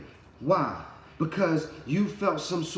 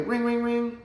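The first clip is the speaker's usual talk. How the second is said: phone ring; 9 semitones higher than usual. Retold or imitated. Imitating a phone ringing.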